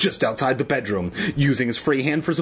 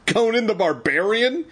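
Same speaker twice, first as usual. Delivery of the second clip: laughing cry; 9 semitones higher than usual. A narrator's reading. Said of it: "he cried, laughing".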